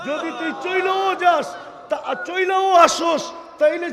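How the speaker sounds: loud, high preaching cry; fervent shout. Acted. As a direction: call out with full preaching force. Preacher voicing God calling out. (উচ্চস্বরে ওয়াজের ডাক)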